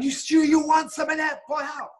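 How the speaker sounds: high, loud mock shouting; shouted threat from the ringside crew; retold shout. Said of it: "And he's shouting".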